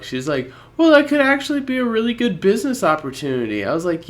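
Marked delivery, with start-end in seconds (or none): 0.8-3.6 high, fast, airy Maddie imitation; mock Maddie. Speaker imitating Maddie defending giving out his number, high and fast. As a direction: chirp it out naively, much higher.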